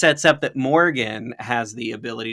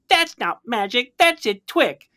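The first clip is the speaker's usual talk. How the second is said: put-on high childish voice, "twick" for trick; a cute kid with a speech impediment; lisping little girl; 9 semitones higher than usual. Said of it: "cute little speech impediment".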